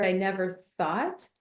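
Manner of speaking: ordinary talk, nothing marked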